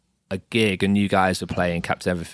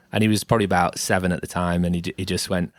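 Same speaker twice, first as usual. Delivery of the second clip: normal talking voice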